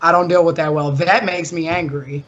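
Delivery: ordinary talk, nothing marked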